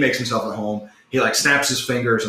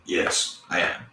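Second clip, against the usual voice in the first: soft, low bashful admission; 4 semitones lower, 5 dB quieter, slower than usual. Acted. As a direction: admit it shyly, keeping the voice down. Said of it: "He kinda blushes".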